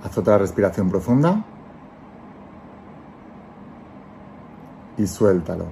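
calm guiding voice, let each phrase settle; guided meditation. Acted. Leads a guided visualization.

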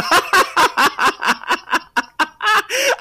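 loud, high cackle; helpless high laughter; laughing fit. Real, not acted. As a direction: crack up mid-sentence with a long laugh. Cracking up mid-sentence.

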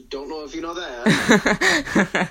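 laughing through the line; can't hold back the laugh. Real, not acted. Repeating the line, cracking up.

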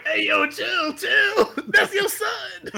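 high, laughing mock protest; mock alarm. Pleading with Soldier Boy to stop, high laughing mock alarm. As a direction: squeal it out while laughing. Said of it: "I was like".

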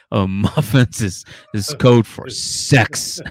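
slow loud mock confession; mock interviewee. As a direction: drag it out like a nervous candidate. Mock job interview answer.

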